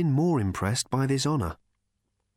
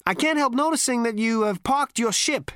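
brash, swaggering space rogue; cocky Zaphod; 11 semitones higher, 4 dB louder than usual. A narrator's reading. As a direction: sneer it at the robot. Zaphod's character voice.

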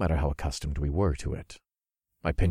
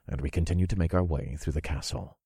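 ordinary talk, nothing marked

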